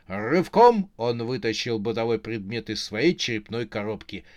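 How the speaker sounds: ordinary delivery, nothing marked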